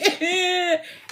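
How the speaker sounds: high-pitched pretend crying; imitated kid's wail after a pinch; hammed-up, drawn-out high cry; mock wail. Child pinched, breaks into crying.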